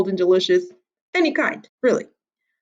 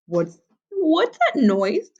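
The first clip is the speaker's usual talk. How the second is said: nervous granny; 9 semitones higher, slower than usual. A narrator's reading. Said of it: "Granny asked".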